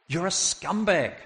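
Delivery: high, falling condemnation; indignant rebuke. Acted. Rebuke repeated forcefully.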